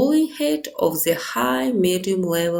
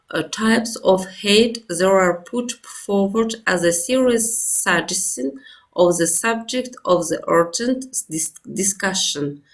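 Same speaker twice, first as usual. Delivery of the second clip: normal talking voice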